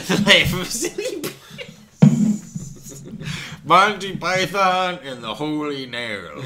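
mock title voice.

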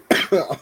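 coughing on water gone down wrong; sputtering cough; choking cough. Real, not acted. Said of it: "Oh, God, I'm choking on the water".

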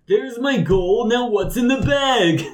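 high, slow, insistent buyer; high slow challenge. Acted. Rich buyer challenges the merchant, high and slow. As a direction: pitch it up and drag each word. NPC slams gold down.

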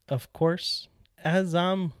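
ordinary delivery

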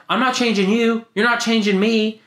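high-pitched insistent protest; retold arguing. Retold argument with brother.

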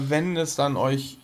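usual speaking voice

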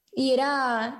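ordinary delivery

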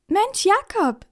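put-on boy beaming at old friend. A narrator's reading. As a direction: greet his friend with a big grin. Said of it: "strahlt Finn".

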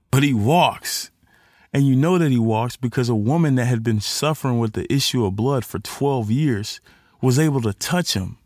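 normal talking voice

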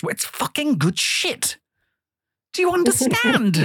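lightly voiced impression of Nico, slow; a hint of Nico's accent, drawn out; half-hearted Nico impression; imitating Nico. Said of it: "in the words of Nico".

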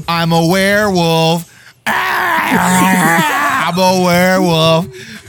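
loud, slow hammy werewolf wail. Retold or imitated. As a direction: belt it like a bad horror-movie werewolf. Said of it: "sounds like Doofenshmirtz doing a bad, like, werewolf impression".

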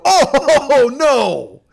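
loud, high groaning oh no, falling away; loud retold wail of dismay; dismayed outburst. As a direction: cry out in exaggerated horror, drawn out. Retold loud dismayed reaction in airport.

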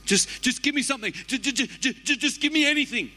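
high, stuttering and begging; stammering desperate plea. Acted. Preacher acting a starved person begging for anything, high and stuttering. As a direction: stumble over the words from hunger. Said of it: "So it's like".

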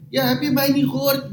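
high mock of broken Dutch. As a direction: squeak out the delivery guy's broken Dutch. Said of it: "Maar dan met de meest gebroken taal". (hoog nagedaan gebroken Nederlands)

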